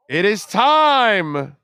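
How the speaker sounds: slow, loud proclamation; grand rich voice. Pretend. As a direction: announce it slowly like an oracle. Summoning the Sportstradamus.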